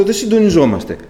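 high, loud frustrated remark; retold quarrel with God; exasperated complaint. Retold arguing back with God.

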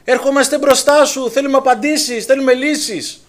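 urgent loud plea, slowing at the end; crowd crying out. Acted. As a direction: cry out as a whole desperate people. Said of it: "ο οποίος φωνάζει".

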